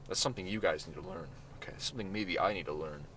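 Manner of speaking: quiet aside. Real, not acted. Aside to listeners.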